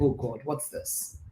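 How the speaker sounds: a quiet groan of frustration in words; muttered aside. Retold or imitated. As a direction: say it to yourself, defeated.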